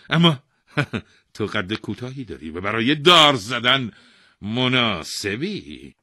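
loud royal voice with a chuckle; domineering king. A narrator's reading. King choosing the short man for the gallows, domineering. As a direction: explain loudly, gloating at the end.

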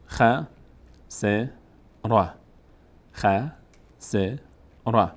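"خ، س، ر" as isolated syllables with long gaps; one by one. Shown as a demonstration. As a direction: spell out "خ، س، ر" one at a time. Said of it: "حرفها رو یکی یکی بخوانید". (جدا جدا)

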